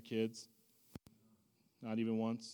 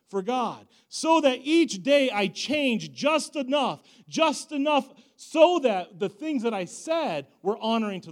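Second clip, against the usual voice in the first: loud, high, impassioned preaching; fiery pulpit testimony, slowed on key words; full-force preaching; 9 semitones higher, 10 dB louder than usual. Acted. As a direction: preach it out to the whole room.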